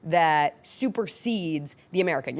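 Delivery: ordinary delivery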